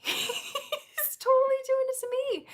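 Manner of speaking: very high, slow squeal after a laugh; hammed-up fangirl thrill; giddy mock realization. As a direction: squeal it in delighted disbelief.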